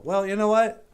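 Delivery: normal talking voice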